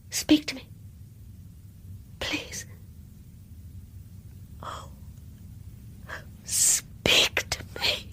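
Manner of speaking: slow, breathy whisper; whispered plea. Acted. Girl whispering to the silent old woman's spirit. Pleading with dead woman's ghost.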